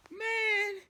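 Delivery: a drawn whiny man; high mock groan. Telling his bad-luck day, whining high. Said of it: "I'm like".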